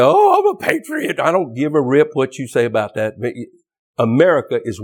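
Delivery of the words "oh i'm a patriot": mock patriot. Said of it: "throw your flag at me and say".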